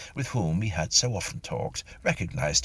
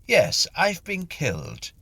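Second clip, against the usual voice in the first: higher, louder, slow solemn declaration; ghost's voice; 11 semitones higher, 4 dB louder than usual. Acted. Mister Tilly tells the medium he died, slow high declaration. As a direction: announce it gravely, slowly. Ghost announces his death.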